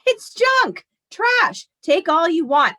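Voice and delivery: high, loud voicing of Grandpa brushing it off; gruff Grandpa voice. Grandpa tells young Grandma to take the junk, high and loud. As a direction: toss it away like worthless junk.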